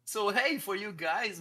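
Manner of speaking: high, loud hey to the crowd; excited welcome. Real, not acted. Streamer welcoming raid viewers, high and loud. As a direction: open with a big bright hey.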